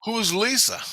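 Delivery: dad begging his daughter for answers; pleading. Retold or imitated. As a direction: ask it high with a crack of distress. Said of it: "I go".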